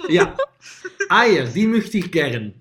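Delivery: slow, hammed-up German villain accent; movie villain german accent. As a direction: drag out each German word like a film baddie. Showing off German phrases.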